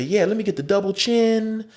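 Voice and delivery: high voice listing items; mock food order. Acts out improved joke order.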